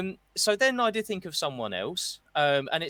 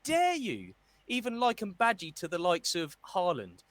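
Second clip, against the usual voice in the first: mock outrage; 8 semitones higher than usual. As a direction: play offended Badgie. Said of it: "Badgie himself".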